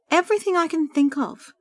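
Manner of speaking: high, strained-sounding anguish. A narrator's reading. Sandra answers her friend about her efforts, anguished. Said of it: "she replied in anguish".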